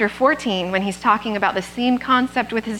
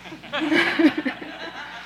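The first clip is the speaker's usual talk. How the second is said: light laugh; 6 semitones higher than usual. Real, not acted. Joke about asking Curtis.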